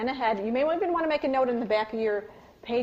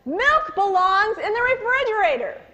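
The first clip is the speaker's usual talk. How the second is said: high, loud but informative command; useful yell; 10 semitones higher, 7 dB louder than usual. Shown as a demonstration. Parent telling child where milk goes, a loud instructive yell. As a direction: yell the rule, not the insult. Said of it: "yell about what needs to be done".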